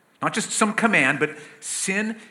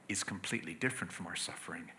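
hushed aside; 7 semitones lower, 15 dB quieter, faster than usual. Real, not acted. Preacher drops to contrast Jesus' suffering.

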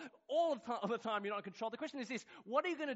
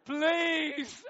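loud, high, hammed-up begging; pleading; 9 semitones higher, 10 dB louder than usual. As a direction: imitate Dad desperately imploring God. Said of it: "I heard him plead with the God".

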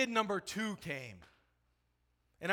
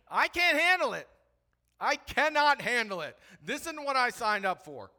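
overwhelmed outburst; 7 semitones higher, 7 dB louder than usual. Retold or imitated. Recalls overwhelmed thinking.

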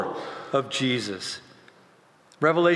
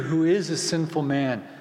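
ordinary talk, nothing marked

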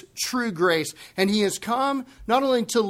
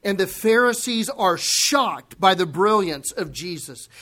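usual speaking voice

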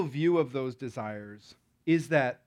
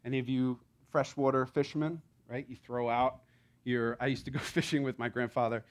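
usual speaking voice

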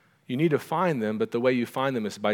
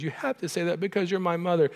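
higher, lightly imitated tearful protest; pitched-up retold crying, not real sobs; mock tearful boy; 7 semitones higher than usual. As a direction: imitate a child protesting through tears. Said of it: "through his tear voice".

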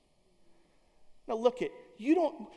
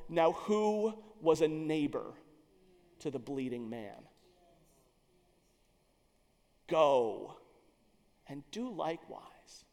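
gentle Jesus; 5 semitones lower, 7 dB quieter, slower than usual. Retold or imitated. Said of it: "look at him and go".

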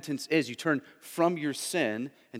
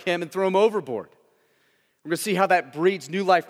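fervent preaching; 5 semitones higher, 6 dB louder than usual. Acted. Preaching at full force.

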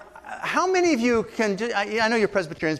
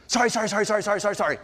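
machine-gun string of apologies; hurried, run-together mock apology; rapid-fire blast; 2 semitones higher, much faster than usual. Shown as a demonstration. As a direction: blast them out to get it over with. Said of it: "just get it over with and blast you".

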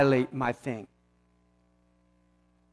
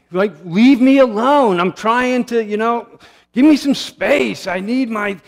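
louder, slow, higher mock snap "leave me alone"; irritated outburst; 6 semitones higher, 7 dB louder than usual. Young father interrupted at the computer by his child, irritated slow outburst. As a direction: play your younger self losing patience, louder and higher.

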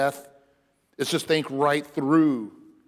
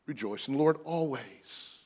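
softer, reflective Rejoice in the Lord always; quiet repeat; 3 semitones lower, 8 dB quieter than usual. Shown as a demonstration. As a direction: say it again gently and softer. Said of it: "not a condition upon circumstance again".

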